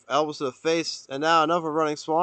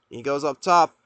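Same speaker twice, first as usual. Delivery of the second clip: ordinary talk, nothing marked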